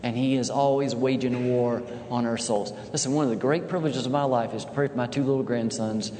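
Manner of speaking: ordinary delivery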